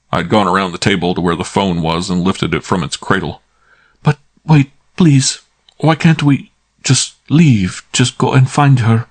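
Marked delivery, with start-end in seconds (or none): 4.0-9.1 put-on higher and louder, slow pleading girl's voice; a frightened woman begging to leave; pleading young woman. Naya begs Archie to just leave, pleading.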